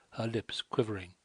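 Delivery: plain reading voice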